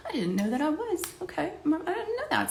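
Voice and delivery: high, light, taken-aback protest; mock bewilderment. Answering friend who called her Southern, mock bewilderment.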